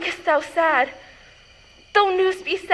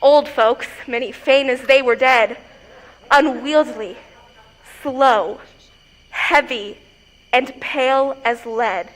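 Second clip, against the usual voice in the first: low, heavy, halting delivery; ponderous disdain; 5 semitones lower than usual. Acted. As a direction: drop low and drag out each sluggish word. Juliet grumbles at slow old folks.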